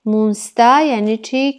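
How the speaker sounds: normal talking voice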